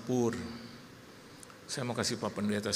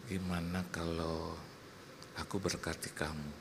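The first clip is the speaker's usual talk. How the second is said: coaxing husband; 8 semitones lower, 8 dB quieter than usual. Retold or imitated. Husband offering treats to his upset wife, soft coaxing. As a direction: soothe gently, voice dropped low. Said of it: "Saya langsung bilang".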